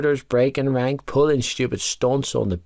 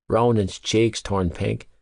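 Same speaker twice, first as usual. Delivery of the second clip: plain reading voice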